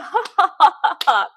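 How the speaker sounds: high, loud mock rich laugh with claps; rich man's laugh. Drunk attorney laughing it off at his own table, mock rich laugh. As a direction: ham up a smug tycoon chuckling. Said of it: "you know that rich laugh?".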